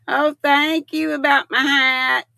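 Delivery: ordinary delivery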